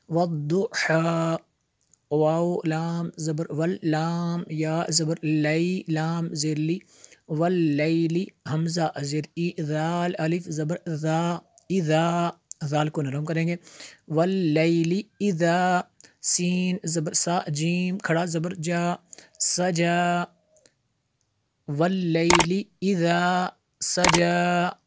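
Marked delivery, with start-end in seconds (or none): 0.1-12.3 measured Quran spelling drill; spelling-out recitation. Shown as a demonstration. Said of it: "जोड़ के साथ पढ़ेंगे". 14.1-24.8 measured Quran spelling drill; spelling-out recitation. Shown as a demonstration. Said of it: "जोड़ के साथ पढ़ेंगे".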